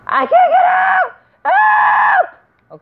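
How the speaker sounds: mock trapped victim. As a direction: squeal like a helpless victim stuck in a car.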